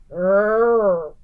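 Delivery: short grumpy growl. A narrator's reading. Bear warned about eating nuts, growling. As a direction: growl back over the nuts. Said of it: "growled Old Bear".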